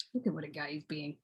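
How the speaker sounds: low, soft aside; quiet aside. Pretend. Remarking on the little guy to oneself, quiet aside. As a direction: drop right down, as if to yourself. Aside about the one addressed.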